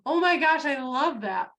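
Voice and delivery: high, loud delighted gush; excited reply. Retold or imitated. Coach cheering niece's post, high and loud. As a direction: burst out with delight. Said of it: "I'm like".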